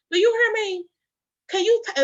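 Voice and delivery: high, loud re-enacted challenge; indignant confrontation. Retelling her confrontation with the nurse, indignant. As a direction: demand to be heard.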